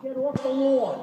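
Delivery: a high, loud Long Island scold; a Long Island neighbour yelling, in a high, loud Long Island accent. Retold or imitated. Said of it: "That's a Long Island accent".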